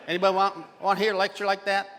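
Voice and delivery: fast, rushed lecture delivery; rapid-fire talk. Shown as a demonstration. Lecturer showing the audience how fast he can talk. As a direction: rattle it off at high speed. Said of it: "I can talk like this the rest of the day".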